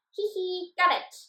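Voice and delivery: high, loud goblin cackle of triumph; gleeful goblin. Acted. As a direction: crow like a cheeky goblin. Goblin catches the stolen wand.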